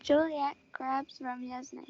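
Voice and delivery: usual speaking voice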